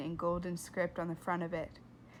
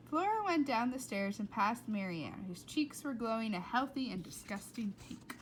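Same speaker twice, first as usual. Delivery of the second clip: plain reading voice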